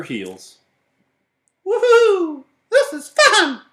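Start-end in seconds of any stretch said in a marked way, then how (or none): 1.6-3.6 very high loud whooping with a laugh; high loud giddy cheer; gleeful whoop. Acted. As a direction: squeal with delight. Pig Mercy cheering in chase.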